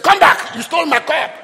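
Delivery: high, forceful shout; loud accusation. Acted. Governor's men stopping the brothers over the cup, shouted accusation. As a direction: call them back and accuse them loudly. Acted accusation in Bible story.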